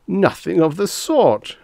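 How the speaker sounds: put-on indignant protest; forced outraged indignation. A narrator's reading. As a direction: fake the outrage. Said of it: "forcing a note of outraged indignation into his voice".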